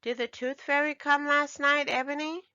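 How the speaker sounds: big brother curious; brother asking. A narrator's reading. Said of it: "Harvey asked Ebony".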